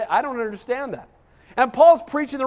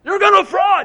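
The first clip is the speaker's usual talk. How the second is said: high, loud mock hellfire shout; mock street-preacher yell; 9 semitones higher, 8 dB louder than usual. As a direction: yell it like a preacher on a box with a megaphone. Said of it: "screaming with a megaphone".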